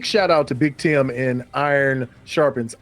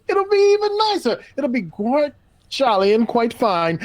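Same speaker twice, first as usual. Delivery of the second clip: mock posh British; 9 semitones higher than usual. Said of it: "if you do it in your accent".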